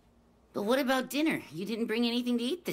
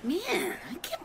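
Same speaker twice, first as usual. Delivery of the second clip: whisper; 3 semitones higher than usual. Acted. Grumbling aside, whispered.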